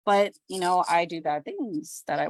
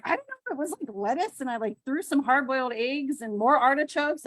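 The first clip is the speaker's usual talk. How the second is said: high helpless shrug; 5 semitones higher than usual. Retold or imitated. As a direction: sound cluelessly delighted. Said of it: "I'm like".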